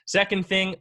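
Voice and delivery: ordinary talk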